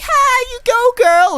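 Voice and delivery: very high, loud squealed cheer; mock girlfriends whooping support; hammed-up falsetto cheerleading; cheering friends. Voicing friends cheering on the zipliner.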